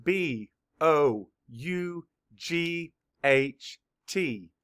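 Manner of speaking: B O U G H T spelled out letter by letter, slowly; teacher spelling B O U G H T for children. Shown as a demonstration. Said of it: "Spell it".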